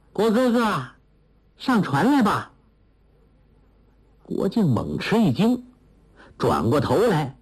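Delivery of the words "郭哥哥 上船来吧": put-on higher-pitched girlish call; young girl calling out. A narrator's reading. Girl calls Guo Jing to board her boat, calling sweetly. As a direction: call him lightly over to the boat. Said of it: "叫道".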